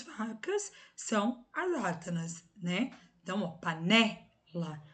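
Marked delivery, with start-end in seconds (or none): 3.5-4.8 panela with the middle syllable punched, pa-NÉ-la; "panela" broken up, NÉ the strongest syllable; stress on NÉ. Shown as a demonstration. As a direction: lean hard on NÉ in "panela". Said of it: "né é a tônica".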